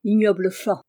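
ordinary delivery, nothing marked

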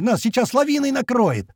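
very high panicky warning; alarmed yell. A narrator's reading. Man fearing the avalanche, alarmed yell. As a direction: cry out at a straining high pitch. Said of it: "снова заорал Рыжий".

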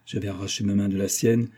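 ordinary delivery

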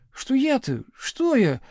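high, sheepish put-on voice; bashful mumbling. A narrator's reading. Puny boy to teacher, bashful high mumble. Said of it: "застенчиво улыбался и бормотал".

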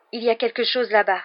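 a hoarse alarmed warning. A narrator's reading. Ron warns Harry of something ahead, hoarse. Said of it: "dit Ron d'une voix rauque".

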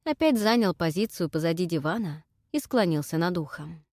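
ordinary delivery

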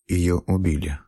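ordinary delivery

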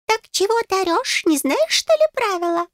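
high-pitched little fairy voice; squeaky, impatient fairy; testy fairy. A narrator's reading. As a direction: scold in a tiny high voice. Fairy scolding the narrator.

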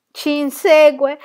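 slow, loud, high cry of dread; shuddering fear. A narrator's reading. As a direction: cry out in drawn-out terror. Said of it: "rabbrividì Jenny". (grido lento di terrore)

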